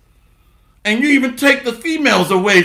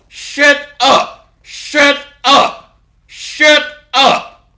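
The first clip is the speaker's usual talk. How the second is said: higher, louder repeated shout; 6 semitones higher, 4 dB louder, much slower than usual. Real, not acted. Preacher shouting down whining men, loud shout. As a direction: shout them down three times.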